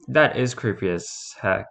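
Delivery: ordinary delivery, nothing marked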